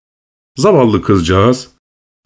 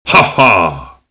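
a short mocking king's laugh; cruel laugh; 5 semitones lower than usual. A narrator's reading. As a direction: laugh down at him like a tyrant. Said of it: "diye güldü".

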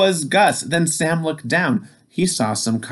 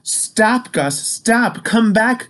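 calling out; 10 semitones higher, slower than usual. Acted. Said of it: "called Sam".

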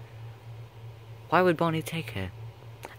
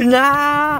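loud shout; 12 dB louder than usual. Acted. Playful loud refusal.

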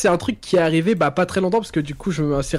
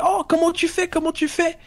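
amazed fans; 12 semitones higher than usual. Retold or imitated. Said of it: "les gens, ils me disaient".

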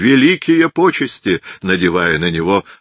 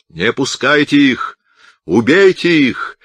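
slow, higher, louder angry yell; crowd yell; 7 semitones higher, 4 dB louder, slower than usual. A narrator's reading. Angry crowd urges the others to stop the monks, slow loud yell. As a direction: roar it out for the mob.

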